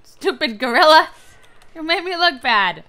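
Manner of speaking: mock scolding. Scolding a gorilla in game.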